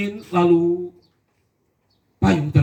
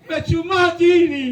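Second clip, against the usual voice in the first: mock scream; 11 semitones higher, slower than usual. Falling recruit cries out in hammed-up panic. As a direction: wail like you're falling. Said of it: "dia teriak".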